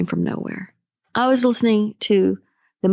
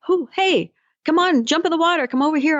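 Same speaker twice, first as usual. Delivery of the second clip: higher, cheery rescuer calling across water; friendly call; 7 semitones higher than usual. Retold or imitated. Coach voicing the boat rescuer calling to the sinking man. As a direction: wave him over brightly.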